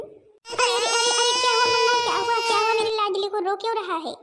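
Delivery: put-on high, loud alarmed cooing over a crying baby; alarmed fussing over baby. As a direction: rush in anxiously soothing the baby. Grandmother fusses over crying baby.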